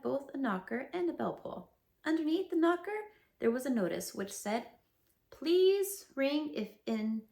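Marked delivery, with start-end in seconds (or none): 5.3-7.2 slow deliberate reading. Acted. Reading Owl's misspelled notice.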